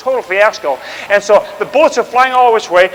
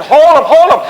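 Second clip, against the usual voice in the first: shouted order; 6 semitones higher, 5 dB louder than usual. Retold or imitated. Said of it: "the Americans are saying".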